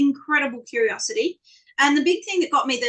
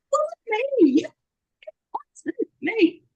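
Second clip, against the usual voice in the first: mock indignant; 12 semitones higher than usual. Answering colleagues gloating after rugby.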